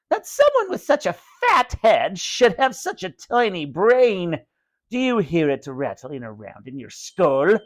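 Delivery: high creepy puppet voice, mocking; raspy dummy taunt. A narrator's reading. Slappy insulting Johnny, high raspy mocking voice. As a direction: sneer it like an evil puppet. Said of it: "Slappy rasped".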